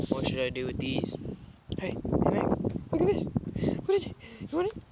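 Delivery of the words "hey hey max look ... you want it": high squeaky voice for a pet. Real, not acted. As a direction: coo at the dog in a high voice. Baby talk to dog.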